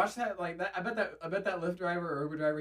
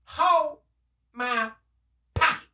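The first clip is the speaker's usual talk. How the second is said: high, loud, word by word; stand-up bit imitation; 9 semitones higher, 6 dB louder, slower than usual. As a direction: mimic the catchphrase with a pause after each word. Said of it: "did you see the one, the stand-up".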